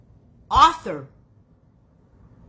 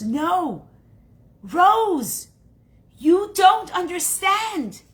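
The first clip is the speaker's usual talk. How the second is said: high, loud, emphatic correction; urgent insistence; 10 semitones higher, 7 dB louder, much slower than usual. Acted. Cousin tells Rose she doesn't understand, urgently insistent. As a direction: cut in urgently, stretching the key words.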